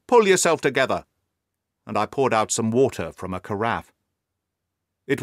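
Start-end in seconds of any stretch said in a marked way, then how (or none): 0.1-1.1 loud, firm order; firm cry. A narrator's reading. Doctor steadying a hysterical patient, loud firm order. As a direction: snap him out of it, loud and firm. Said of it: "I cried".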